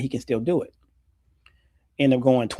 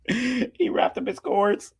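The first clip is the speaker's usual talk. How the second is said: slow and high, laughing through it; fighting back laughter; 8 semitones higher, slower than usual. Real, not acted.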